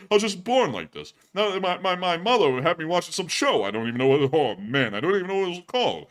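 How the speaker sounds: hammed-up hard-luck stand-up comic; Rodney. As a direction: rant like a put-upon old-school comedian. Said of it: "with that Rodney voice".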